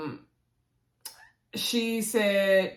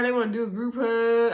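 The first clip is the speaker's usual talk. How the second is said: ordinary talk, nothing marked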